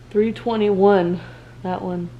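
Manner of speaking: normal talking voice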